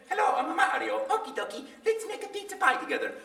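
high, playful Italian-accented Mario voice. Shown as a demonstration. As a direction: go full cheerful cartoon plumber. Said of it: "Italian plumber from Brooklyn".

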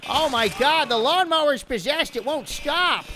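high, loud hammed-up alarm; mock panic. Player panicking as the lawnmower won't stop, mock alarm. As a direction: cry out in pretend horror.

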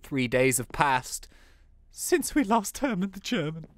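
slow, drawn-out story caption reading; mock-solemn, unhurried storyteller; solemn narration. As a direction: narrate the passing days in mourning.

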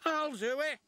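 high, keen engine voice; eager volunteering. A narrator's reading.

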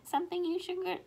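plain reading voice

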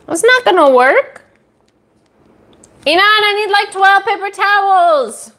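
yelling. Real, not acted. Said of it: "Why are you yelling at?".

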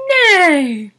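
high, loud neighing nay; horse's shout. Acted.

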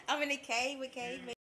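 high, loud hype; excited. Real, not acted. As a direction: shout it out hyped. Hyped chant in cave.

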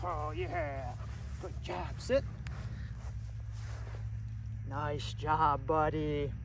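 low, soft praise. Real, not acted. Trainer praising puppy after sit, low and soft. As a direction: murmur praise to the dog.

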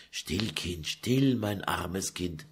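put-on calm her like a crying child; hushing. Mother hushing her weeping daughter, very soft.

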